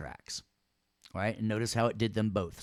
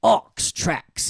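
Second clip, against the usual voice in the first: Aux tracks called out loud and high; excited callout; 10 semitones higher, 9 dB louder than usual. Retold or imitated. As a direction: call it out like a quiz answer. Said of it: "he says".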